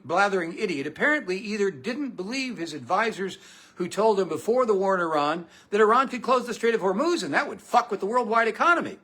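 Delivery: ordinary delivery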